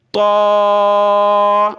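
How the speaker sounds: heavy تو, not thin تا; full heavy تو; heavy toe. Shown as a demonstration. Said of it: "یہاں ہم نے پڑھنا ہے".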